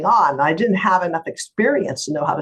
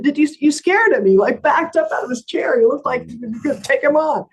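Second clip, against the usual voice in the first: high, louder, excited imitated client; amazed; 10 semitones higher, 4 dB louder than usual. Client telling lawyer she scared the attorney, amazed and excited. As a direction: recount it in impressed, high-pitched excitement. Client marvels at her outburst.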